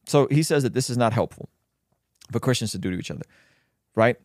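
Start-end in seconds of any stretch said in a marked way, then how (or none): none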